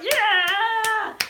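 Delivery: long, high, loud whoop; hyped crowd cheer. Retold or imitated. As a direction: cheer like a thrilled cinema audience. Re-enacts the cinema crowd cheering.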